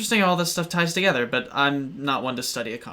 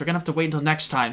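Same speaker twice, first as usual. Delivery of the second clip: ordinary talk, nothing marked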